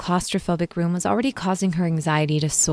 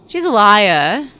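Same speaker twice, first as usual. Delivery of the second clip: put-on loud, slow, higher Dracula-style vampire accent; 5 semitones higher, 11 dB louder, slower than usual. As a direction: boom it out like Count Dracula. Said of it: "suddenly sound like Dracula".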